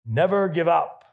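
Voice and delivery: lightly channel Churchill's rallying cry; Churchill impression. Pastor quoting Churchill to the congregation, lightly voiced defiant orator. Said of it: "Or if you're Winston Churchill".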